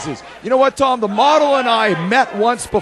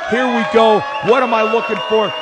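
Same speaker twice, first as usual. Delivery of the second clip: ordinary talk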